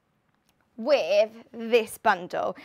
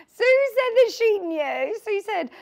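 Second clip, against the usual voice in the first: laughing; 10 semitones higher, 6 dB louder than usual. Real, not acted. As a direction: still giggling as she reads it. Laughing about viewer message.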